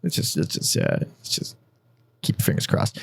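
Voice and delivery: unvoiced, hushed whisper. Real, not acted. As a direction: whisper it like a secret wish. Whispered hope.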